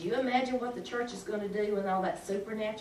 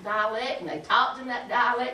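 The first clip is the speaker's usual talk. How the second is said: ordinary delivery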